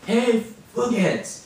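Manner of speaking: repeating slowly. Retold or imitated. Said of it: "Cal, say it".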